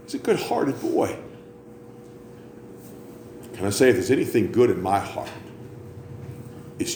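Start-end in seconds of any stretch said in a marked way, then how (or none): 0.0-1.2 mocking. Mocking the ladies' phrase.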